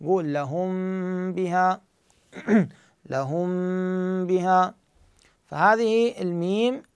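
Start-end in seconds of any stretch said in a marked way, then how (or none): 0.3-1.8 لهم بها with the m of لهم hummed long on lightly touching lips; concealed meem with ghunnah. Shown as a demonstration. Teacher demonstrating the lip touch to students, long hummed meem. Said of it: "فقط تلامس بين الشفتين هذا يكفي". 3.1-4.7 لهم بها with the m of لهم hummed long on lightly touching lips; concealed meem with ghunnah. Shown as a demonstration. Teacher demonstrating the lip touch to students, long hummed meem. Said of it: "مو أنسى الغنة".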